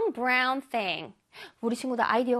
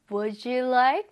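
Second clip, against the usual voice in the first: gentle would you like with a rising end; soft and kind; much slower than usual. Shown as a demonstration. Teacher modelling a friendly offer for students, soft and kind. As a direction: offer it politely, smoothly. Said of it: "부드럽게".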